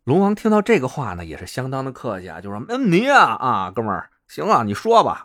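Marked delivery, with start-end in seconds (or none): 2.7-5.2 put-on hearty Beijing-accented Dragon King. Dragon King promising the visitor help, Beijing accent. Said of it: "听着口音，这是北京锁龙井里边那龙王吧".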